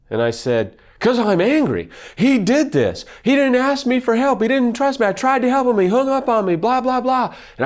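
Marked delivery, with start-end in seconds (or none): 1.0-7.4 retold angry outburst. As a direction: replay the angry rant to his wife, pitched way up. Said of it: "I was really frustrated and really angry".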